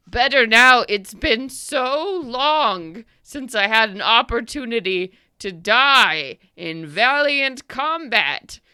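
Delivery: a giant pleading for a glorious death; eager old giant. Acted. Old giant asking the party to end him, loud and slow.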